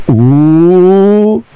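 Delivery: high singsong "Ooh, you gonna get in trouble"; mock warning. Schoolkid teasing a friend who swore, singsong warning. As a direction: tease like a schoolkid. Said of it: "That's what he used to do".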